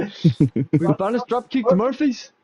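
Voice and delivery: slow, high, loud Irish declaration; proud mock Irish ban; Irish accent. Friends joking, an Irishman banning the band.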